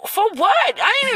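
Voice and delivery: slow, high, drawn-out "for what"; indignant disbelief. Real, not acted. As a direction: stretch the question in disbelief. Outrage at his threat.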